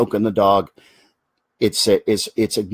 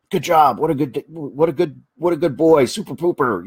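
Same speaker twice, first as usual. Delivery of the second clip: high, bubbly praise for a good dog; talking to your pooping dog, high happy praise; puppy praise voice; 4 semitones higher than usual. Shown as a demonstration. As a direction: gush over the dog like it's a toddler. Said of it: "I praise him".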